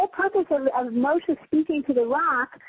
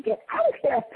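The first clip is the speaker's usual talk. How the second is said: sudden high yelp at the cat; irritated cry at the cat by the door, much higher; shooing the cat; 12 semitones higher than usual. Retold or imitated. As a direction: shoo off a pesky cat. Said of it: "I kick this cat".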